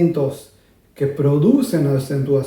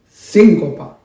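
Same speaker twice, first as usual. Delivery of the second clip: síncopa with SÍN punched much louder in a quick burst of air; exaggerated accent; 8 dB louder, slower than usual. Shown as a demonstration. Teacher exaggerating the air impulse of the accent for students, loud. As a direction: blast a fast puff of air on SÍN. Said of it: "sobre todo si lo exageran".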